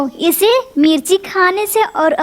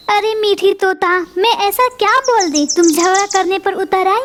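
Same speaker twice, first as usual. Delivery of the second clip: usual speaking voice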